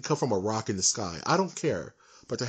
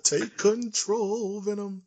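much higher, slow cartoon plea; mock cartoon cry; 9 semitones higher, much slower than usual. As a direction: stretch it out high like a cartoon hero. Echoes friend's cartoon line.

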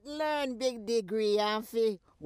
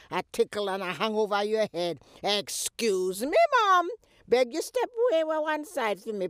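ordinary talk, nothing marked

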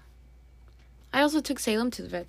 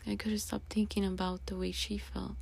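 whisper; 10 dB quieter than usual. Real, not acted. Remembering dying grandma.

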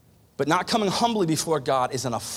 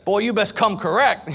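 seventh-grade slang; 6 semitones higher, 5 dB louder than usual. Retold or imitated. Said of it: "We used to talk like that".

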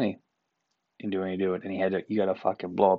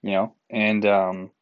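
ordinary talk, nothing marked

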